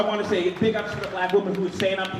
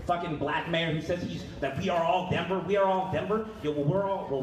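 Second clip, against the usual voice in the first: usual speaking voice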